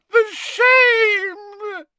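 high elf wail, loud and sobbing; anguished house-elf howl; bawling cry. A narrator's reading. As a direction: cry out in shame. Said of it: "She buried her face in her skirt again and bawled".

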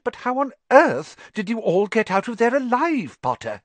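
sharp, rising questioning; stern teacher. Acted. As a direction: press for an answer.

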